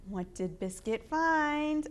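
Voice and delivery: plain reading voice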